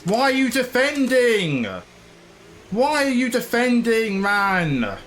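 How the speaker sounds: high, drawn-out frustrated complaint; exasperated. Real, not acted. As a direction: demand an answer, stretching the words. Pleading with rival car defending.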